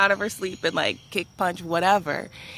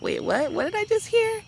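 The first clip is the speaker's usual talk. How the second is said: groggy surprise; 3 dB louder than usual. Retold or imitated. Groggy narrator retells waking up.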